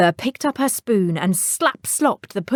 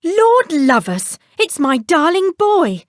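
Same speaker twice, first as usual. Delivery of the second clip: high, slow, loud delight; overjoyed to find her boy; relieved mother; 9 semitones higher, 5 dB louder than usual. Acted. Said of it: "said his mother".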